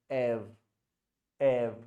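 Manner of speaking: ève with an open e, held; open è sound. Shown as a demonstration. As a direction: stress the ève ending with an open e. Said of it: "because it is accent grave".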